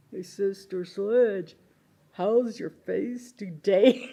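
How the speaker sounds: loud, slow, drawn-out taunt; teasing coworker. Retold or imitated. Coworkers tease injured Sister Sledge over the mic, mock call-out. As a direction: call it out slowly down the mine phone.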